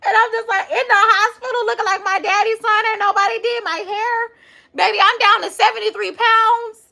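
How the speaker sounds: high, loud, laughing gush; excited gushing. Retold or imitated. Telling viewers about the hospital proposal, giddy and high.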